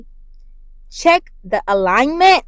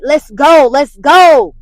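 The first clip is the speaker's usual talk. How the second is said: loud, pumped-up "let's go" chant; hyped-up cheer; 4 semitones higher, 9 dB louder, much slower than usual. Retold or imitated. Pumping herself up each morning, loud excited cheer. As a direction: fire yourself up for the day. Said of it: "every day I'm like".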